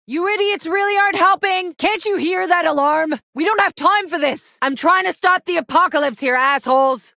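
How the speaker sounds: high-pitched exasperated shouting; furious yelling. Acted. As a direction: scream at the idiots in frustration. Furious at enemies during alarm.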